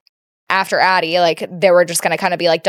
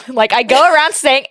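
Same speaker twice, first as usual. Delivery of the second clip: ordinary talk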